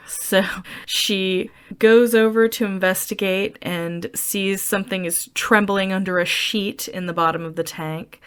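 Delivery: usual speaking voice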